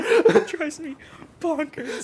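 high-pitched, cracking up while talking; real laughing. Real, not acted. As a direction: barely get it out for laughing. Laughing at the absurd line.

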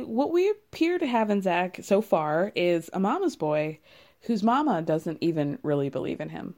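ordinary talk, nothing marked

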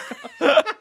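squealing laugh up high; high burst of laughter. Real, not acted. Laughing at his own train joke, high squeal.

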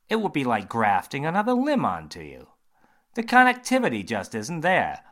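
a confident doctor holding forth; surgeon voice. Acted. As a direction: surgeon quizzing James, bright and loud.

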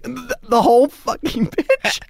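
slow, high-pitched disbelieving question; incredulous. Retold or imitated. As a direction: stretch it out in re-enacted dismay. Acts out disbelief at request.

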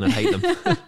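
high squeal of laughter; laughing. Real, not acted. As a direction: laugh the words out. Joking about Kent rivalry.

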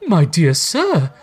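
high, slow, wondering character voice; overwhelmed gentleman. A narrator's reading. Gentleman thanking Scrooge, overwhelmed. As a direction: draw it out, overcome with gratitude. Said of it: "said the other".

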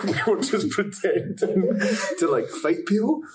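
giggling through the words; laughing. Real, not acted. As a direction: laugh while telling it. Laughing at a funny memory.

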